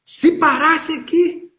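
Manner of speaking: slow, high rhetorical echo; dramatic musing. Acted. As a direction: stretch out the question up high. Preacher pondering Jesus stopping.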